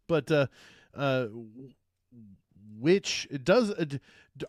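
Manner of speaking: talking through hiccups; hiccuping mid-sentence. Real, not acted. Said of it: "Excuse me, I got the hiccups now".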